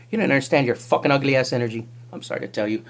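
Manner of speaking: normal talking voice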